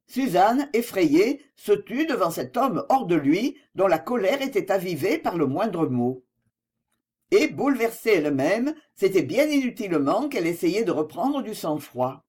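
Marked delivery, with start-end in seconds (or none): none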